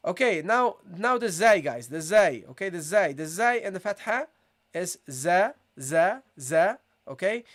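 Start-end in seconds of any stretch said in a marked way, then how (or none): 5.1-6.8 Arabic letter zay drilled slowly. Shown as a demonstration. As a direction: clear open za syllables. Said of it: "Now you have to attach it".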